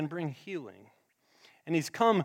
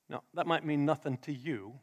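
usual speaking voice

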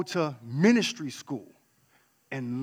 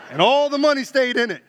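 higher and louder, laughing through it; 8 semitones higher, 9 dB louder than usual. Real, not acted. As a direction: laugh at your own punchline. Joking about the collection plate.

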